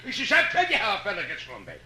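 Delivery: normal talking voice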